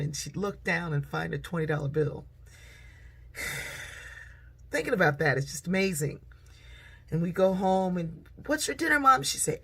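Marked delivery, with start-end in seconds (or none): 3.3-4.2 soft, breath-only sigh; heavy sigh. Real, not acted. Speaker moved by memory of mother's prayer, soft sigh. 8.5-9.2 put-on high-pitched child's question; eager child asking. Children home from a walk asking Mom, high-pitched eager question.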